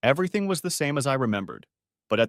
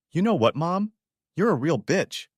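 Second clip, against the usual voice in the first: sharp, louder, higher insult; lashing out; 7 semitones higher, 3 dB louder than usual. A narrator's reading. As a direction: spit the insult at her. Son lashes out at mother.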